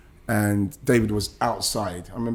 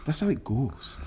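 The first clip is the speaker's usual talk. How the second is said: softer, clipped Scottish manager imitation; Scottish accent; 3 semitones higher, 7 dB quieter than usual. Boss consoling young Ian after his friend's sale, in a soft Scottish accent. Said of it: "I'm quite pleased I've done the accent".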